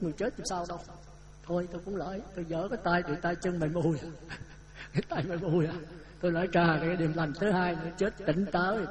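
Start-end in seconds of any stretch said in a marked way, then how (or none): 4.0-4.9 quiet breathy chuckle; a brief hushed laugh; soft laugh. Real, not acted. Laughs at the limp hands. (tiếng cười khẽ)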